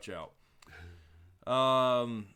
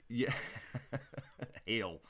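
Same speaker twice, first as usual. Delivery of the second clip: normal talking voice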